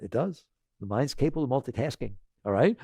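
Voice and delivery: ordinary delivery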